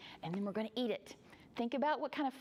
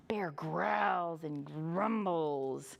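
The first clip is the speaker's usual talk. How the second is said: slow, low growly telling; low, drawn-out storybook growl; growling narration; 5 semitones lower, much slower than usual. A narrator's reading. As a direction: stretch the words into a bear's growl.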